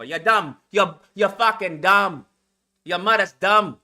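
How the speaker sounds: mock-Jamaican scolding rant; hammed-up Jamaican accent, often dropping low; put-on Jamaican accent. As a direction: rant in an exaggerated Jamaican accent, punching the insults. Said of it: "learn how to speak in the Jamaican language".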